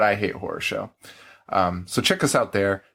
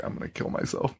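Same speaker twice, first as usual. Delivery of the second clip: mock despair; 9 dB quieter than usual. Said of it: "that guy just was like".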